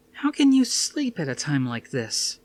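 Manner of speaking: Suzanne, incredulous. A narrator's reading. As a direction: voice Suzanne, stunned at him. Narrator voices Suzanne.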